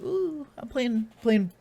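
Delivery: high, slow, airy mock of a clueless streamer girl; hammed-up ditzy gamer girl voice; mocking girly streamer. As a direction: coo it like a vapid streamer. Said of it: "She's like".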